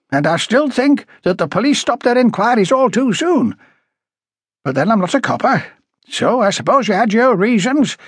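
high, insistent character voice; opinionated high-voiced woman. A narrator's reading. Woman questioning police about their old inquiry, high and opinionated. Story character, Miss Graham.

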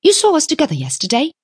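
high, quick firm reminder; dignified. A narrator's reading. Young woman reminds businessman of her partner, dignified. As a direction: remind him crisply, pitched high. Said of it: "said Tuppence with dignity".

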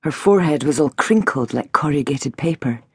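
usual speaking voice

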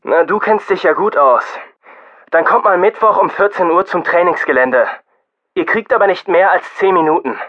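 friendlier press officer. A narrator's reading. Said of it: "dramatisch freundlicher klingenden Pressesprecher".

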